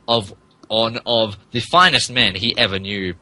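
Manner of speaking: usual speaking voice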